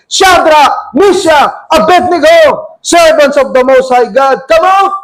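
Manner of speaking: high, urgent call into the furnace; acted-out royal shout; shouting king. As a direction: call out loudly to men inside. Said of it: "approached the opening of the blazing furnace and shouted".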